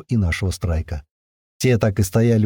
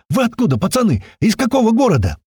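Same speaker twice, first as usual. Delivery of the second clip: high, eager shouted questions; excited yelling; 12 semitones higher, 3 dB louder than usual. A narrator's reading. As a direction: fire questions at them, voice pitched high. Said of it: "продолжал тот орать".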